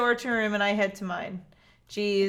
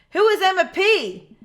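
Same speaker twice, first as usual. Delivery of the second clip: slow, higher, louder panicked question; 9 semitones higher, 8 dB louder than usual. Acted. Dad worrying about his late daughter, panicked. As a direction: spiral into panic over a stranger's name. Worried dad spiraling at midnight.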